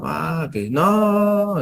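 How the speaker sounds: imitating a moaning patient; mock patient groan. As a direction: stretch it out like a confused patient. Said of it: "gritos, gruñe".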